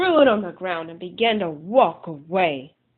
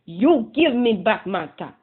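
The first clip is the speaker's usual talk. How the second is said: loud scolding call; scolding monkeys; 2 semitones higher, 7 dB louder than usual. Acted. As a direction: shake a finger and scold them. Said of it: "he said".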